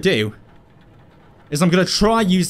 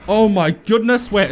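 usual speaking voice

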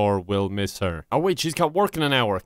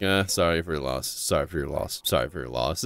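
lower, bored condolences on repeat; mock mourners; 9 semitones lower, 4 dB quieter than usual. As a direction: drone it like empty lip service. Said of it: "It's like".

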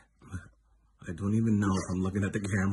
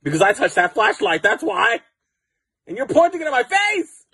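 much higher and louder, building outrage; rising rant; 13 semitones higher, 14 dB louder than usual. Real, not acted. Yelling at the friend shining the flashlight, comic shout.

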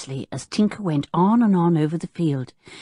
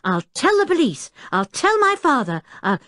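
put-on high, heated boy's threats; high-pitched childish outburst; losing his temper; 9 semitones higher than usual. A narrator's reading. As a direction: threaten in rising indignation. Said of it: "losing his temper".

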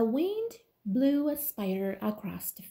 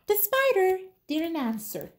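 ordinary delivery, nothing marked

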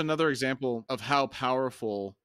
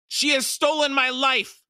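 high, loud outcry of accusation; anguished scream; 13 semitones higher, 9 dB louder than usual. A narrator's reading. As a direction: scream it out at full pitch. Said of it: "The Maib screamed".

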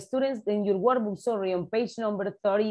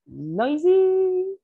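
high, loud, drawn-out NOISY; noisy demo; 11 semitones higher, 6 dB louder than usual. Shown as a demonstration. Teacher showing the class what noisy means, noisy squeal.

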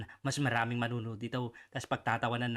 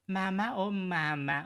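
slow, higher, singsong "mama"; mock mama's boy call; 5 semitones higher, slower than usual. Teasing the senator, a slow singsong mama's boy. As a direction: drag it out like a clingy child.

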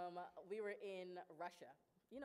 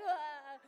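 loud, high hammed-up bawl; exaggerated cry of being overwhelmed; mock emotional wail; 7 semitones higher, 13 dB louder than usual. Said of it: "I'm very emotional".

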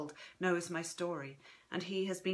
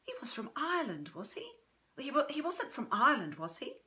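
higher-pitched, puzzled granddaughter; higher, uncertain questioning voice; wondering; 6 semitones higher than usual. A narrator's reading. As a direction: wonder aloud, checking what you remember. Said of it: "she wonders".

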